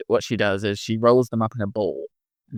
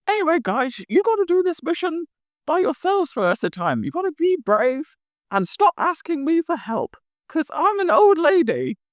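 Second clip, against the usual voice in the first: Mrs Claus voice. Acted. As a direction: play a fretful old Mrs Claus, much higher. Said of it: "Missus Claus goes".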